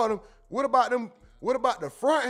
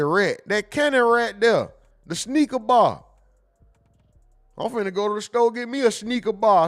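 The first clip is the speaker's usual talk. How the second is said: put-on country accent; 2 semitones higher, 6 dB louder than usual. A country friend correcting the streamer on "sneaker bar". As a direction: bark it out in a thick country accent.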